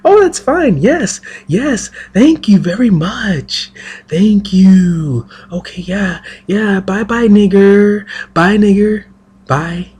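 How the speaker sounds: high mock impression of people smiling then insulting. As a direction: sneer it in an exaggerated put-on polite tone. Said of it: "That is basically what they're saying".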